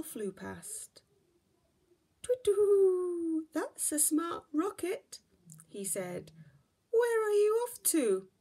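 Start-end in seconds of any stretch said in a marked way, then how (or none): 2.2-5.2 much higher, louder hooting owl. Acted. Owl character speaks. 6.9-8.3 much higher, louder hooting owl. Acted. Owl asks Baby Bear.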